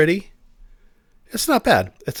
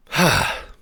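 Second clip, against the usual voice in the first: sigh; 3 semitones higher, 4 dB louder than usual. Real, not acted. Sighs while choosing next song.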